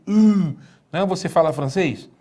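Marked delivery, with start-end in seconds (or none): 0.1-1.0 U with rounded pursed lips like French u; French-style U. Shown as a demonstration. Teacher showing the Greek ypsilon sound, French u with pursed lips. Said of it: "aquele U do biquinho".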